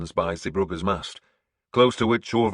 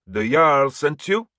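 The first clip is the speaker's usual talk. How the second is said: Orvar's voice; 8 semitones higher, 7 dB louder, slower than usual. A narrator's reading. Orvar questions the narrator.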